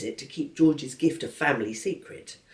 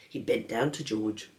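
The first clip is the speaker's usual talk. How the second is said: ordinary delivery, nothing marked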